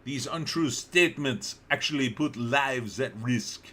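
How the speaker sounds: a lightly put-on Azerbaijani accent; made-up Azerbaijani accent. Host reading a lawyer's statement, mock Azerbaijani accent. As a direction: read the quote in an invented Azerbaijani accent. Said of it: "That was my Azerbaijan, uh, accent".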